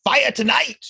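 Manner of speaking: high, loud announcer opening; show host intro. Acted. Hosts open the show.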